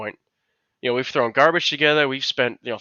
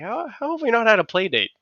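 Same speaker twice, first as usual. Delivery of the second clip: squeaky high playful voice; high-pitched mock excitement at a friend; mock high squeal; 10 semitones higher than usual. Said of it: "I'm like".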